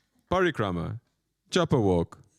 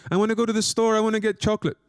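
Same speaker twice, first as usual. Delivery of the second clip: put-on whiny child; 9 semitones higher, faster than usual. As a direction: play the nagging kid begging for chocolate. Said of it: "tell a naughty child".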